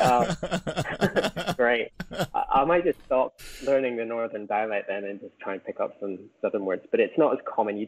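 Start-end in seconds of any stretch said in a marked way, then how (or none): 0.0-1.9 higher, laughing, mock-cheerful "Oh, great"; deflated joke about giving up; laughing sarcastic "great". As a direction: brush off the correction with a sarcastic laugh. Mock enthusiasm at being corrected.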